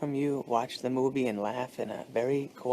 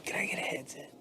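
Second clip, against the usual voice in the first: unvoiced whisper asking; whispered request; 5 dB quieter, faster than usual. Passenger whispering to flight attendant. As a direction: ask quietly not to disturb.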